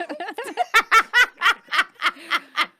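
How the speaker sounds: loud high laugh. Real, not acted. Laugh after thank you.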